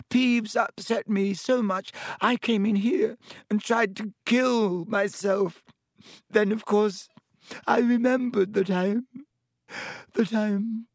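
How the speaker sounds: high, tearful melodrama, faltering at the end; dramatic. A narrator's reading. Myrtle recalls trying to kill herself, dramatic. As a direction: wail it tragically and break off. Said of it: "said Myrtle dramatically".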